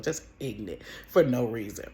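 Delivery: ordinary talk, nothing marked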